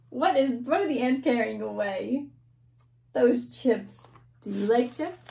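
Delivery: ordinary talk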